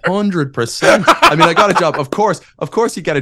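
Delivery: laughing high and loud through the words; real laughter breaking into the answer. Real, not acted. To the host about landing a wife before a job, laughing.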